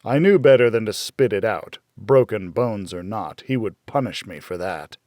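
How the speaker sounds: ordinary delivery, nothing marked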